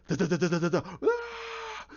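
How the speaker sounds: machine-gun sounds ending in a mock scream; imitated gunfire and scream. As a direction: mimic shots then a cry. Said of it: "then they're hearing".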